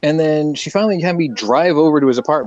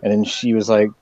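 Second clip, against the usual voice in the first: ordinary talk, nothing marked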